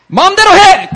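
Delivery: very high, very loud angry yell; a father yelling do whatever you want; furious shout. Retold or imitated. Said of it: "he's like".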